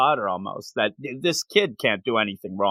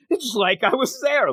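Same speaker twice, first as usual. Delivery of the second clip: pitched up high, a light hint of the amazed principal; awed principal; 10 semitones higher than usual. Principal marveling at the girl's reenactment, lightly voiced higher. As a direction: lightly play the principal, impressed. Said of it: "even the principal's like".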